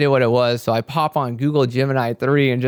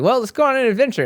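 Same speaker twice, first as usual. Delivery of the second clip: much higher, bright adventurous lilt; playful excitement; 12 semitones higher, 4 dB louder, faster than usual. Retold or imitated. Setting off to find the hot tub, playfully excited. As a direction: sing it out like a kid setting off. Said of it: "So I was like".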